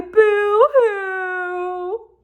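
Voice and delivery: high mock wail; mock boo-hoo cry. A narrator's reading. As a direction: ham up the Whos' sobbing. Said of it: "will all cry".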